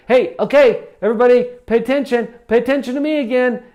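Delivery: a flustered trainer yelling for attention, fast; frantic shout. Retold or imitated. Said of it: "some trainers go to the front of the room and they say".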